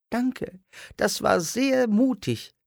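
very high little puppet voice, thankful; a tiny dwarf thanking his rescuer; grateful dwarf. A narrator's reading. Freed dwarf Otto thanks Simon in the park, very high.

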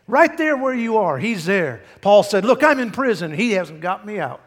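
loud, high-pitched fervent preaching; full-force preaching. Real, not acted. Preacher assuring folks the Lord is with them, fervent shout. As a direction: drive every phrase home to the congregation.

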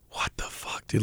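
a hushed, breathless whisper of disbelief. Retold or imitated. Director recalling a surreal moment on set, whispered disbelief. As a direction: whisper it like you can't believe your life. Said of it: "she was like".

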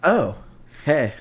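imitating own acted surprise; mock startled greeting; fake surprise. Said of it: "I try to act surprised".

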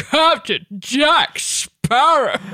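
very slow, exploding sea-villain voice; drawn-out theatrical villain call; Davy Jones impression. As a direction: stretch and pop every word of the name. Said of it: "able to know that was Davy Jones".

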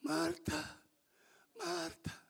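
barely audible, from the deathbed; faint dying husband. Retold or imitated. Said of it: "con apenas voz audible pequeñamente le dice".

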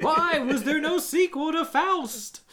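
high, slow whining complaint; upset Seinfeld-style whine. Pretend. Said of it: "sound like Jerry Seinfeld when he's upset".

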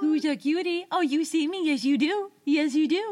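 high, loud, gushing pet voice; baby talk to a dog. Pretend. Ghost greets a dog that sees it, baby talk. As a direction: fuss over the dog in playful singsong.

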